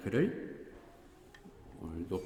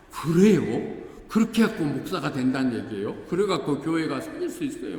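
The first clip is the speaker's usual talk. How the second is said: amazed questions; 5 semitones higher, 6 dB louder than usual. Retold or imitated. Friend's amazed reaction retold.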